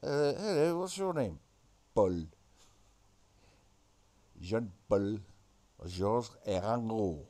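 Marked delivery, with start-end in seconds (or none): 1.9-7.2 low, emphatic mock-French names; French accent. Naming the Beatles as in Frogland, mock French accent. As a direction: intone each name in a deep French accent. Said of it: "in Frogland".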